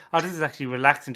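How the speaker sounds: ordinary talk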